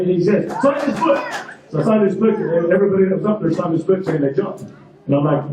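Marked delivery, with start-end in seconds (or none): 0.6-1.2 high-pitched yell over engine noise; retold yell. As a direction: yell it as a jump instructor. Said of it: "he yells at me".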